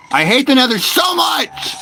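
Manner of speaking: high shout, hitting "so" hard; furious shout. Real, not acted. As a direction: yell it out in real rage at the game. Raging at Minecraft Nether game.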